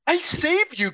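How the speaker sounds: high, pleading cry from the woman. A narrator's reading. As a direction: cry it out in shock at the bite. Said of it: "cried that woman".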